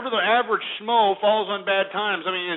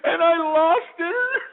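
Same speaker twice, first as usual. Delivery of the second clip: much higher, slow, whimpering mock sob; mock crying; 11 semitones higher than usual. Mocking a crying Madoff victim on TV, hammed-up sobbing. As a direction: ham up a sobbing rich woman. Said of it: "she was sitting there crying".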